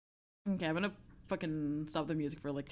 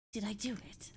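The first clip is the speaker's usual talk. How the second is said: softer whispered breathy take; whispered retry; 8 dB quieter than usual. Shown as a demonstration. Streamer checking the Voldemort voice again, whispered. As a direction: breathe it out instead of voicing it. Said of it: "D-, did I do it?".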